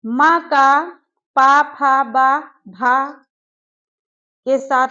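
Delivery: each syllable called out loud and slow; teacher drilling sounds loudly. Shown as a demonstration. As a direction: call out the letters slowly for the class. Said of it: "अब देखो".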